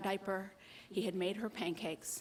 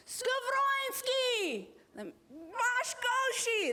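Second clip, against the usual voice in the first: very slow, much higher drawn-out calling; imitated yell; 3 dB louder, much slower than usual. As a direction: call out through the door to wake the house. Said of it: "he's yelling".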